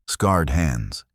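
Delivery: usual speaking voice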